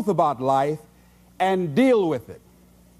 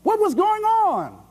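bewildered; 9 semitones higher than usual. Retold or imitated. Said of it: "they have to ask themselves".

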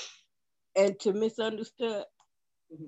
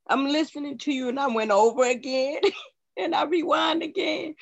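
higher-pitched, crying, halting between phrases; tearful; 5 semitones higher than usual. Real, not acted. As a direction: speak through tears with long pauses. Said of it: "[name] over there crying".

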